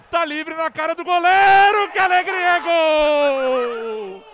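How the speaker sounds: long screamed goal call; explosive goal commentary. Real, not acted. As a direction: scream the goal with all you have.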